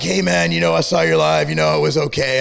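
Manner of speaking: low, mock-casual DM voice; imitated rival. Mocking the rival CEO's DM in a low voice. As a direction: drop way down to play the rival. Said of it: "I get a D M like".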